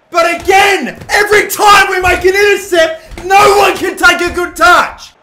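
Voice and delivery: very loud, high, furious yelling; furious shout. Real, not acted. Manager yelling that nobody can take a good touch, furious shout. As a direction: scream it at the players. Ranting after another turnover.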